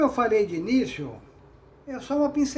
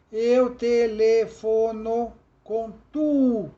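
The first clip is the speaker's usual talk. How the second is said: "eu telefono com tu" scanned slowly, syllable by syllable, louder; syllable count, slow; 6 dB louder, much slower than usual. Shown as a demonstration. Teacher counting syllables of "eu telefono com tu", slow and loud. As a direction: spell out the meter of "eu telefono com tu". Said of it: "Essa é a sílaba tônica".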